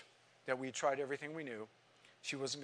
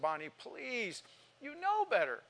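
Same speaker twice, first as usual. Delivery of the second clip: tearful pleading; 8 semitones higher than usual. Retold or imitated. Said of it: "And I was crying".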